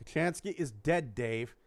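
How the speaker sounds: lightly voiced deadpan computer; Holly. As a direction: repeat the bad news evenly, dropping on the name. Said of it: "who's the ship's computer".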